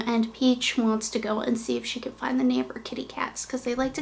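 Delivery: soft unvoiced whisper. Real, not acted. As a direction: hush so the dog won't hear. Whispers about the dog.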